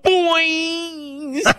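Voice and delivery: high, drawn-out catchphrase call; mock yell. Joking about calling out to [name], high mock yell. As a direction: imitate a yell without full volume. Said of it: "And I yelled out".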